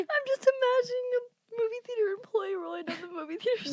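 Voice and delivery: high, laughing words; crying with laughter. Real, not acted. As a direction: barely get it out through laughs. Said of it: "dying laughing right now".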